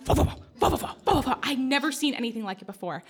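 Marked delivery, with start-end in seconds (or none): 0.0-1.4 imitating cat snoring. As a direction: puff out little cat snores. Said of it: "just like".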